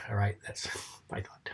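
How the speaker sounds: soft breathy whisper, almost to himself; whispered aside. Real, not acted. Whispering his hope to the old friend, barely audible aside. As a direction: let the thought slip out in a whisper. Hushed aside after hoping for romance.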